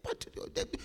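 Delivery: a mock secret in a whisper; whispered aside. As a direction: whisper it, unvoiced and soft. Taunt dropped to whisper.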